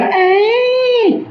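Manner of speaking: long, high, steady "ay"; light-ray sound effect. Pretend. Teacher showing students a light ray arriving, high sustained sound. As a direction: hold one high note for the travelling ray.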